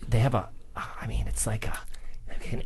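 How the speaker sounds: hushed aside; whisper. Real, not acted. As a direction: lean in and whisper it. Lowers voice to confide.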